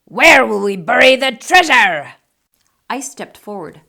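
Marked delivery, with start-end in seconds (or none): 0.0-2.1 loud worried shout; loud alarmed captain; holler. Acted. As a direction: cry out over the storm. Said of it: "hollered Braidbeard".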